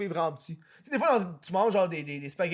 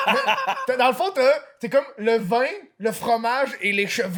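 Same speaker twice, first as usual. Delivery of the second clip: laughing; 5 semitones higher, 5 dB louder than usual. Real, not acted. Laughing at cheese joke.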